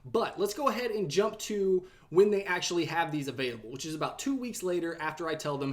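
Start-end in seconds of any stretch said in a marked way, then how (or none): none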